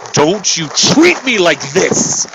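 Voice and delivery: slow, loud, forceful warning; angry warning. Retold or imitated. Finger in her face, angry slow warning. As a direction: spell out each word angrily. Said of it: "I said it very angry".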